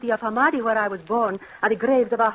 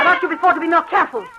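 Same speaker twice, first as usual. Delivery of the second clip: quick, raised, higher scolding; fast scolding; 8 semitones higher, 7 dB louder, faster than usual. Acted. Wife scolding husband for hurting her cat, fast and loud. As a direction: snap at him to be careful.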